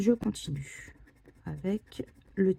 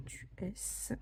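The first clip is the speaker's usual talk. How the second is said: whisper; 14 dB quieter than usual. Real, not acted. Scratching ticket, murmuring to self.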